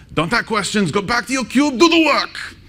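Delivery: mock German boss yelling in a terrible German accent; bad German accent. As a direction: play a bossy manager with a deliberately awful German accent, rising to a shout. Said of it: "that's a really bad German accent".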